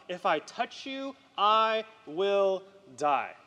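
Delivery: slow, word by word dread; drawn-out dread. Retold or imitated. Peter imagining touching holy Jesus, drawn-out dread. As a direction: draw out each word with heavy weight.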